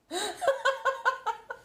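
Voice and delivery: high-pitched helpless giggle; high laugh. Real, not acted. Streamer amused at tripping over their words, high giggling. Laughing at own word slip.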